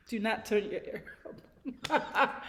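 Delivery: slow, laughing through the words. Real, not acted. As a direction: laugh while taking back the joke. Takes back a joke, laughing.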